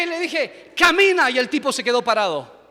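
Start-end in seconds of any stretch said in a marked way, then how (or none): 0.8-1.3 high, loud order; commanding healing cry. Retold or imitated. Pastor tells the man in the wheelchair, commanding. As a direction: throw the word out as a command.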